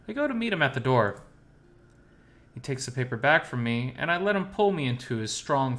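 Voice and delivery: ordinary delivery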